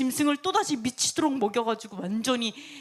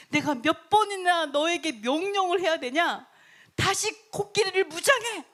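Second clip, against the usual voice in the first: acted-out exasperated command, high; scolding; 5 semitones higher than usual. King orders servant to arm elephants, scolding. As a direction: climb into an imperious high order. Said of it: "헤르몬은 맨날 혼나요".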